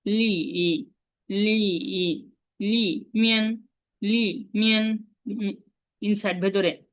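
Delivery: usual speaking voice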